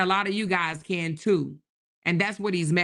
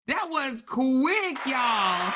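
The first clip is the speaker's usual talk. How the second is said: excited; 5 semitones higher, slower than usual. Real, not acted. Celebrating goal met on livestream.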